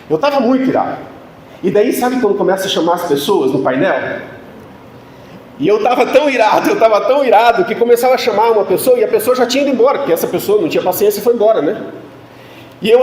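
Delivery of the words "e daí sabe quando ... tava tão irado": usual speaking voice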